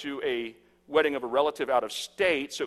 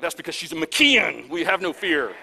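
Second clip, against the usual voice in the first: loud, proud declaration; proud boast; 4 semitones higher, 8 dB louder than usual. Retold or imitated. Dad boasting to the piercer about his daughter, proud boast. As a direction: proclaim the family name with mock bravado.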